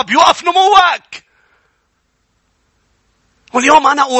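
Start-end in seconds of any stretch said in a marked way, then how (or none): 0.0-1.3 slow, high shout to drive the point home; a loud cry on the warning; preaching shout. Real, not acted. (صرخة وعظ بطيئة وعالية)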